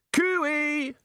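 high, loud echoing call; shouted call. Acted. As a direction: call out into the huge cave. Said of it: "however, shouted".

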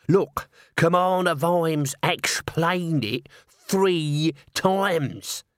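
very slow, lumbering troll. A narrator's reading. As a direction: plod it out heavily. Troll explains slowly.

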